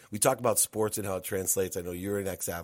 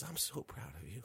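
proud mom; 17 dB quieter than usual. Retold or imitated. Mother tenderly reassures her son.

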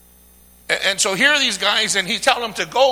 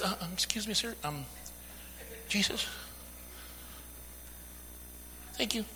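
very soft, hesitant request; acted shy, halting near-whisper; timid, polite murmur; 18 dB quieter, faster than usual. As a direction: imitate someone meekly trying to get attention. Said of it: "He didn't come and says".

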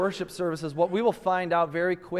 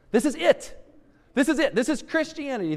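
proclaiming; 7 semitones higher, faster than usual. Acted. Preacher proclaiming climax.

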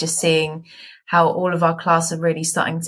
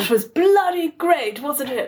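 plummy mock Bath accent, pitched up; posh Bath accent; 8 semitones higher than usual. Shown as a demonstration. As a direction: redo it in a hammed-up posh Bath accent, high and sing-song. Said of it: "slightly worse country, but very posh".

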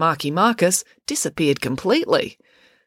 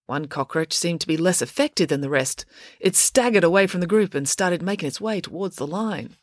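usual speaking voice

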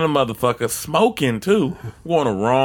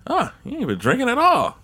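higher cop voice, impressed; mock cop amazed; surprised cop; 10 semitones higher than usual. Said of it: "He was like".

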